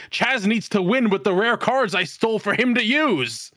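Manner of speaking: high, excited mock fan voice; hammed-up cheerer. Mocking a character beside the headmaster, high and eager.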